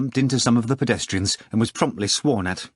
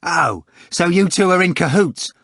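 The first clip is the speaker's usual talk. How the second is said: higher, louder indignant cry; angry cry; 7 semitones higher, 6 dB louder than usual. A narrator's reading. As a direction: confront them, getting angry. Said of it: "Ivan cried, growing angry".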